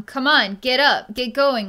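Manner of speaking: loud, pushy commands; the strict energy pushing the client, loud urging. Retold or imitated. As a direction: bark it like a strict drill coach. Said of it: "being strict with you, telling you to".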